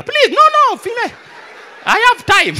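high, loud comic pleading; flustered insistence. Acted. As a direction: insist frantically that they go first. Flustered customer urging the other ahead.

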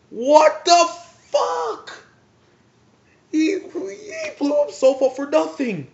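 high, loud, enraged shout; dad's furious outburst. Retold or imitated. Dad yelling at the TV over the wasted sacrifice. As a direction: explode at the screen in disbelief. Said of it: "dad got, like, extremely pissed".

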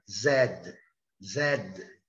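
Zed said with a clear z and a short final d; British zed. Shown as a demonstration. Teacher models the British Zed for students. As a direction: say Zed the British way, a buzzing z and a clipped d. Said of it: "No inglês britânico".

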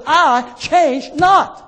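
slow, weighty, word by word; emphatic declaration. Acted. Preacher quoting God to the congregation, emphatic declaration. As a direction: hammer out each word slowly.

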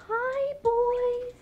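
high, gentle drawn-out coo; soft-edged, high-pitched hello; gentle bird greeting. Real, not acted. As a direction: greet pets high without raising the volume. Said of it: "real soft and real gentle".

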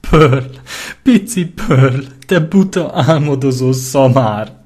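high, loud tearful cry; sobbing whimper. Acted. Lyra weeps over Pearl's body, high tearful cry. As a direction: cry it out through sobs over her friend. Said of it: "nyöszörögte sírástól reszkető hangon".

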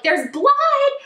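a very high, loud alarmed question; shocked question. Retold or imitated. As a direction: gasp it out in disbelief. Shocked at hearing of blood.

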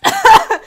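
loud high-pitched cackle; high burst of laughter. Real, not acted.

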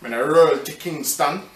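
lightly put-on Jamaican patois, laid back; a hint of a Jamaican accent. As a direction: play a chilled Jamaican guy, lightly. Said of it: "in Jamaican accent".

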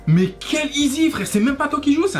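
exasperated, pitch shooting up; indignant outburst. Acted. Indignant reply to cheater.

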